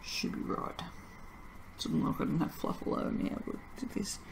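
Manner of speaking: soft whispered aside. Real, not acted. Soaper fixing the soap top, whispered aside. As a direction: whisper to yourself while fixing it. Muttering while working.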